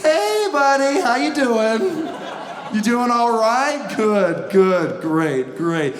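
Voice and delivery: high, sugary fake-friendly greeting; overly friendly driver. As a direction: call out sweetly to the car ahead. Acted friendly chat with driver.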